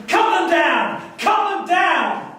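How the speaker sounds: desperate shouting. Acted. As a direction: scream it at the soldiers. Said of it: "The women were screaming and shouting".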